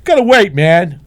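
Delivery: high, loud, slowed mock tough guy; gruff threat. Garbage man warning the stalled driver, mock tough. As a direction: drag out the warning like a street tough.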